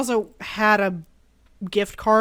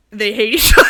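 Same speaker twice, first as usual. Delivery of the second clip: laughing; 10 dB louder than usual. Real, not acted. Laughs mid-line, loud.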